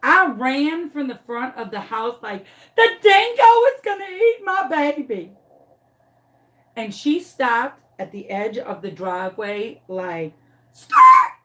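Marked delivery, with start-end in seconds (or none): 2.8-5.3 high, loud wail of alarm; mock-panicked cry. Owner running out to save chickens, hollering in mock panic. 10.7-11.3 very loud, high imitated tire screech; screeching-halt noise. Dog stopping at driveway's edge, sudden high screech.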